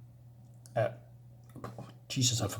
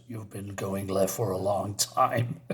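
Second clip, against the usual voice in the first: lightly voiced dry comeback; 8 semitones lower, 8 dB quieter than usual. Partner teasing Rorschach, a low slow deadpan. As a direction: lower and softer, slow, deadpan reply. Comic reply read aloud.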